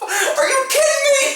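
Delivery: very high, squeaky incredulous outburst; disbelief. Retold or imitated. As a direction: re-enact being utterly stunned. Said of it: "I couldn't believe it".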